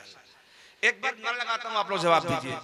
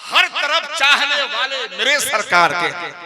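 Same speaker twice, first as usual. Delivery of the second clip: loud, slow recited naat couplet; reciting the full couplet; 5 semitones higher, 12 dB louder than usual. Acted. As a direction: intone the couplet slowly to the crowd. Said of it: "पूरा मैं पढ़ता हूँ".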